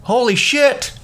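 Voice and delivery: high, stunned outburst of disbelief; shocked disbelief. Retold or imitated. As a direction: blurt it out in shock. Shocked by the rich guy.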